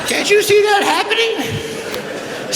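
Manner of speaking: very high, laughing put-on voice; Willie Mays impression. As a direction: squeal it out like a giggling Willie. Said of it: "Here's the way Willie is".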